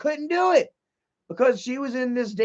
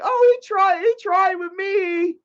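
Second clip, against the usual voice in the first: high, loud whiny mimic of her; hammed-up imitation of the girl; mocking her; 11 semitones higher, 4 dB louder, slower than usual. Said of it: "been like".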